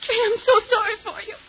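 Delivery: high, crying pity; tearful. Acted. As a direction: sob through the words. Crying over Kay.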